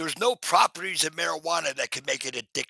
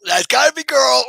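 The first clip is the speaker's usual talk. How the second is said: higher, louder repeat of the guess; more excited; 10 semitones higher, 11 dB louder than usual. Real, not acted. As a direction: push it up with delight.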